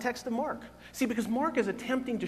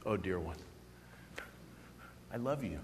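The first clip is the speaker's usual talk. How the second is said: lower, softer, gentle reassurance; hushed loving reply, pitched down; tender Jesus voice; 11 semitones lower, 13 dB quieter than usual. Pretend. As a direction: speak as Jesus comforting a questioner. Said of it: "And he will say".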